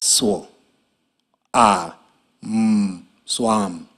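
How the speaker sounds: segment "swam" sound by sound, then blend; sounding out words. Shown as a demonstration. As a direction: say "Swo-, a, m" slowly, then "swam". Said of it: "Listen again".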